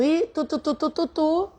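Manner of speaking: quick mouthed tu-tu-tu like tapping keys; typing noises. Retold or imitated. Imitating the attendant typing, soft tu-tu sounds.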